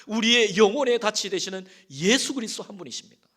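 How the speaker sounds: loud, soaring sermon climax; preaching at full force. Acted. Pastor proclaiming Christ as the anchor, preaching at full force. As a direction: proclaim it over the whole congregation.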